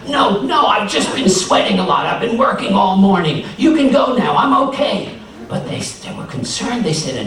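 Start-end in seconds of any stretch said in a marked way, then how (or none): 0.1-5.1 high, loud, strained insistence; strained denial. Acted. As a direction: brush them off while hurting. Anansi hiding pain.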